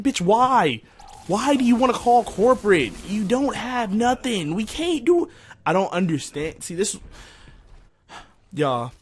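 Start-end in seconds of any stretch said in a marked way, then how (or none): 0.0-5.3 high-pitched pleading protest; exasperated retort. Retold or imitated. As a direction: argue back at an unreasonable customer. Acted retort to complaining customer.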